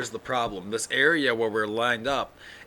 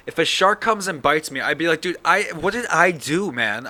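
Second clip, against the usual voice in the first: angry; 6 semitones higher, 7 dB louder than usual. Pretend. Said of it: "I'd be so angry".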